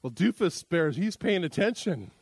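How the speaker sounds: ordinary talk, nothing marked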